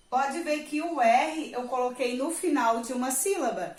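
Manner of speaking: ordinary talk, nothing marked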